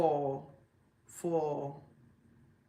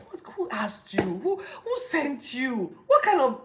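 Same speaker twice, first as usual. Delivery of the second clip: loud, high, incredulous rant with laughing cries; mock the foolish vow, loud and high; exasperated disbelief; 6 semitones higher, 3 dB louder than usual. Real, not acted. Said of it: "I've expressed how stupid I think that promise was".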